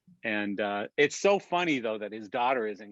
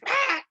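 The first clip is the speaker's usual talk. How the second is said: sudden high yelp, retold; a short, sharp, high-pitched cry; mock scream; 6 dB louder than usual. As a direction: imitate a fake Tourette's yelp. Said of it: "and he goes".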